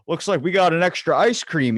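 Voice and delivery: high, loud and upbeat; excited offer. Acted. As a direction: share a lucky find with excitement. Acts out a friendly ice cream offer.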